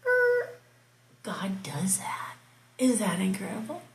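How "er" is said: ordinary delivery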